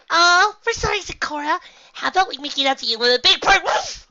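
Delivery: very high, loud squeaky pony; bubbly party pony. Acted. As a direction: gush like an overexcited party pony. Voices excitable party pony.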